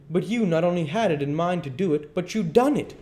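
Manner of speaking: high, emphatic charge; angry accusation. Acted. Duke turning the blame on the king, angry. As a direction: build to the final accusation.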